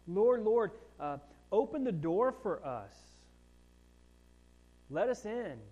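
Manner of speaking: loud begging voice; shut-out guests crying to the Lord, loud and desperate; pleading cry. Acted. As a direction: hammer on the door with your voice.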